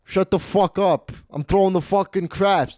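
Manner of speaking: drawn-out mock telling-off, Italian-American style; Stefano. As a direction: imitate a husband brushing off his wife. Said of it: "This is exactly how he talked".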